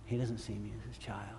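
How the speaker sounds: hushed, defeated self-talk; discouraged inner thought. Acted. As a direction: voice a dejected private thought, very softly. Voicing one's own discouraged thought.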